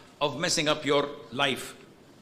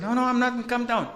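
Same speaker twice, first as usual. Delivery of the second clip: high, raised-voice mock denial; retold indignant denial; 8 semitones higher, 3 dB louder than usual. Husband denying to his wife he is shouting, mock indignant. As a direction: protest too much, pitched up.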